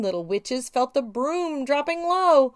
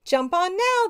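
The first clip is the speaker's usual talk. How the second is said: shout; 8 semitones higher, 4 dB louder than usual. A narrator's reading. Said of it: "they shouted".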